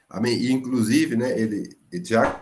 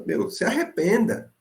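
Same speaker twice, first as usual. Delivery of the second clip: urgent plea; 9 semitones higher than usual. Retold or imitated. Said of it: "ele tá falando".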